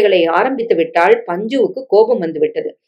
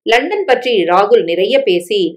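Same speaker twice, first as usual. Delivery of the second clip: plain reading voice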